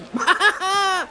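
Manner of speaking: high jeering laugh; mocking laugh. Crowd of animals mocking Grand Patu, high jeering laugh.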